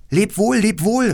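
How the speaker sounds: hoch und laut nachrufen; Abschiedsruf. Acted. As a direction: Lebewohl hinterherrufen, viel höher. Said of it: "riefen der Prinz und die Prinzessin". (Prinz und Prinzessin rufen Gerda nach: hoher, lauter Abschiedsruf)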